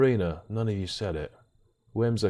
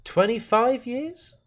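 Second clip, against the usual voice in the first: high, slow incredulous question; twenty-five years, mock amazed; mock disbelief; 12 semitones higher, slower than usual. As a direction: stretch it out in fake shock. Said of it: "I'd go".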